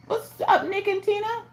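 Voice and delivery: high, bright call-out to viewers; excited greeting. Real, not acted. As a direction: sing out a hello to the chat.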